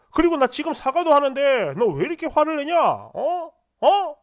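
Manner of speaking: exasperated self-defence, rising in pitch and volume. Retold or imitated. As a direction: argue back angrily, ending on sharp demanding interjections. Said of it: "이러면서 저도 좀 질렀거든요". (욱해서 언성 높여 따지듯)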